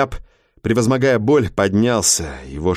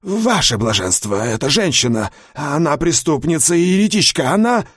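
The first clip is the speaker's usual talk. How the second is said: rising, urgent protest, much higher; agitated protest; 5 semitones higher than usual. A narrator's reading. Stunned guard protests.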